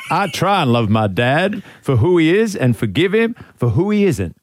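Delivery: put-on half-laughing American Ted Lasso accent; American coach accent with a giggle. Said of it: "get into character".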